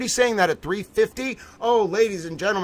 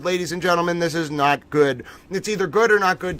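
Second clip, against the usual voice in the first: ordinary delivery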